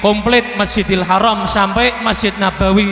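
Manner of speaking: loud and fast prayer to the crowd; rousing sermon prayer, fast and loud; fervent prayer. Real, not acted. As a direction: pray out loud with force. Preacher prays fervently for Palestine.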